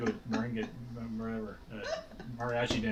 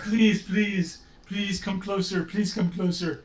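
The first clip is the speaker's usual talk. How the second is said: higher-pitched begging voice; pleading ghast; 6 semitones higher, 3 dB louder, slower than usual. Acted. Game master voices sobbing ghast to the player, higher pleading. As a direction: beg them to come nearer. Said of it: "And she's like".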